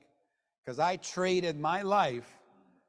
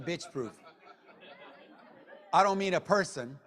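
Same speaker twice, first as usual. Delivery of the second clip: normal talking voice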